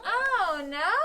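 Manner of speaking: drawn-out "Oh no" lifting at the end; question. Shown as a demonstration. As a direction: ask it, with "no" turning upward. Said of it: "it seems like I'm asking".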